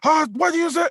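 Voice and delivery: high-pitched mimicked challenge; old woman butting in. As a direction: imitate an older woman getting involved.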